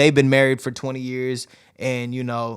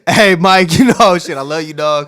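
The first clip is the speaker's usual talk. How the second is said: calling out; 8 dB louder than usual. Acted. Calling out to Mike Tyson.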